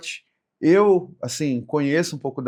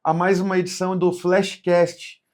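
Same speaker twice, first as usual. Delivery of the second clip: podcast host intro; 5 semitones higher, 3 dB louder, slower than usual. Acted. Podcast opening host read.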